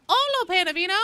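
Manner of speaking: high, loud hail with a rising end; loud, high-pitched welcome; called-out greeting. Real, not acted. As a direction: call out hello to someone just arriving. Calling a greeting to arriving viewer.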